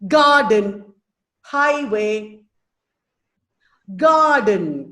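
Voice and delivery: GARden, HIGHway, falling away after the strong start; first-syllable stress. Shown as a demonstration. Teacher gives trochee examples, first syllables of garden and highway stressed. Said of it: "So the first syllable has stress".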